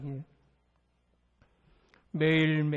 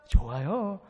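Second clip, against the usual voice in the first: calm acceptance; 5 dB louder, slower than usual. Acted. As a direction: answer the challenge easily and contentedly. Acted calm answer to shouted question.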